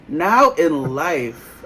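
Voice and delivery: very slow, stretched NOW IN LIFE with a laugh; drawn out. Real, not acted. Co-host owning not caring at this age, NOW IN LIFE slowly drawn out. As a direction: drag out each word of "Now in life". Repeats it drawn out for comic weight.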